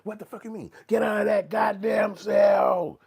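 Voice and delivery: high mock Karen voice building to a slow, very loud shout; a hammed-up Karen snapping into hero mode, high and climbing to a full shout. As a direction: play an outraged Karen who ends up shrieking orders. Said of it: "Superwoman Karen".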